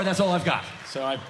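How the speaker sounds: ordinary delivery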